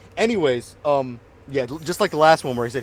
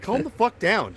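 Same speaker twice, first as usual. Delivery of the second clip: high retold plea to calm down; exasperated retort; 7 semitones higher than usual. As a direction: rein in an overeager guy.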